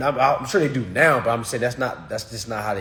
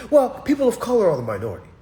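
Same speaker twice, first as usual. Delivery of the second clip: high, rushed mocking answer; mock Democrat voter; 9 semitones higher, 5 dB louder, much faster than usual. Streamer imitating voter's answer, high rushed mocking voice. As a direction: reel it off fast and high, imitating.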